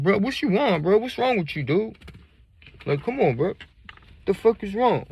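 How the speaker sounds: put-on high, loud whiny drunk; drunk stranger. Drunk stranger whining at the teller, high and loud. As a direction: complain like a confused drunk.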